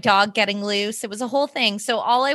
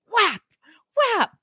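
slap sound imitation; 11 semitones higher than usual.

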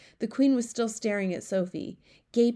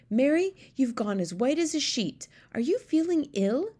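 higher-pitched royal lady, concerned; lightly voiced queen questioning her maid; 7 semitones higher, 3 dB louder than usual. A narrator's reading.

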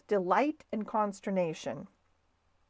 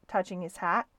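ordinary delivery, nothing marked

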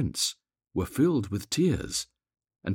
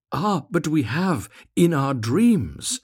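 high, loud, slowly drawn-out reply; dreamy children; 7 semitones higher, 6 dB louder, slower than usual. A narrator's reading. Children answering the mathematical master, slow high dreamy voice. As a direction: answer like starry-eyed children, stretching the words.

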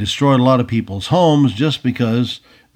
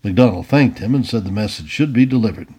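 ordinary delivery

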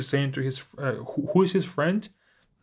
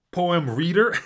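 laughing; 5 semitones higher than usual. Real, not acted. Self-deprecating about her reading.

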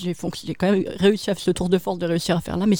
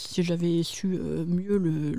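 usual speaking voice

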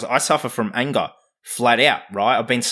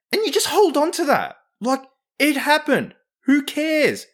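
incredulous scoff; 11 semitones higher than usual. Real, not acted. Mocking holding on to anger.

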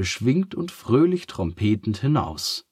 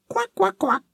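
very high, squeaky little duck, curious; a cheerful duck asking kindly; 3 dB louder than usual. A narrator's reading. Duck interrupts.

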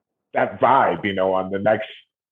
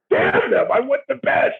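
whiny fan. Retold or imitated. Mock frustrated fan quote.

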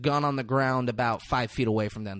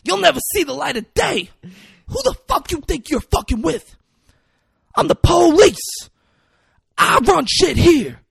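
high, loud imitated rant; full-blast movie villain tirade; Denzel Washington; 11 semitones higher, 8 dB louder than usual. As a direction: shout threats at the whole neighborhood. Acting out Denzel's ranting threats.

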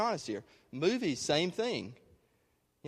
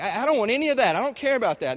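high, loud imitated denial; acted-out insistent disavowal; mock protest; 7 semitones higher, 6 dB louder, faster than usual. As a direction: protest too much on someone's behalf. Acting out a listener's denial.

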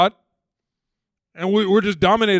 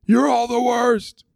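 barking Moses; 7 semitones higher, 6 dB louder, slower than usual. Retold or imitated. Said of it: "barks at everyone".

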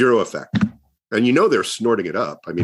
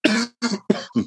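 real coughing fit; harsh throat-clearing cough. Real, not acted. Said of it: "sorry about the coughing".

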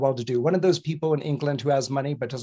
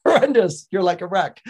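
exasperated; 3 semitones higher, 6 dB louder than usual. Retold or imitated. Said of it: "He's like".

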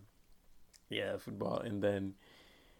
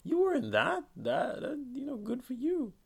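high-pitched mock amazement; mock surprise; 14 semitones higher than usual. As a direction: act amazed at spotting the actor. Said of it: "and you're like".

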